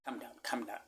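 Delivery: fast, hushed whisper to himself; calming whisper. Retold or imitated. He whispers himself calm in the taxi. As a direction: whisper yourself back to calm.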